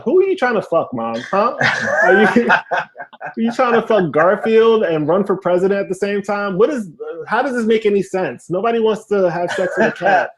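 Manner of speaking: high-pitched, exasperated disbelief; incredulous questioning. Acted. Son confronting mom about her dating profile, incredulous.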